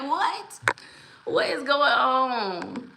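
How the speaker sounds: high, drawn-out amused bewilderment; baffled. Retold or imitated. Host voicing own confusion at the chat, baffled. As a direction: stretch out the disbelief. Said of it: "I'm so confused".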